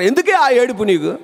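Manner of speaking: high-pitched imitation of a parent; scolding parent. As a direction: ask it like an exasperated parent. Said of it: "అమ్మా నాన్నలు ఉంటారు".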